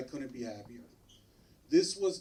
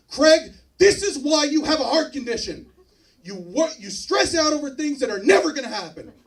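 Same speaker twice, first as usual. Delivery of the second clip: a much higher, louder exasperated doctor yelling at her patient; exasperated doctor shout; 10 semitones higher, 14 dB louder than usual. Retold or imitated. Doctor slams the file and yells at her patient, hammed-up shout. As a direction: blow up at him like a fed-up doctor.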